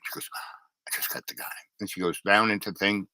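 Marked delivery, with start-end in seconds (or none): 0.9-1.4 whisper. Retold or imitated. Church lady quietly offering help.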